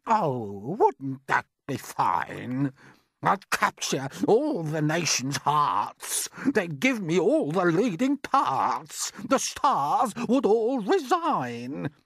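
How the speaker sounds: high, slow, preening aunt's voice; drawn-out mocking call to her sister; vain aunt calling out. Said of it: "Aunt Sponge declared".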